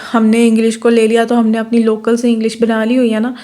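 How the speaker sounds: ordinary delivery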